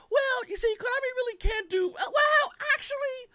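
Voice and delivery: high, far-off whiny voice mocking YouTubers; mocking sycophant. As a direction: play a squeaky toady making excuses. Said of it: "you're seeing a lot of people going".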